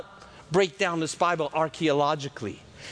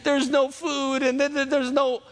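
high, whining mock Israelite complaint; whiny complaining; 7 semitones higher than usual. Israelites complaining to Moses in a high mock whine. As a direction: whine like a hungry crowd. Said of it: "they were complaining".

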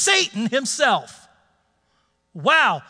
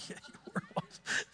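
laughing; 19 dB quieter than usual. Real, not acted. Laughing after his own shout.

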